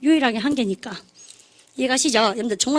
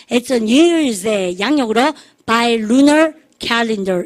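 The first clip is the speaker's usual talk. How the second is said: each word stretched very slow; drawn-out drill for repetition; slow choral model; much slower than usual. Shown as a demonstration. Teacher leads the class in repeating, very slow. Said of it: "시작".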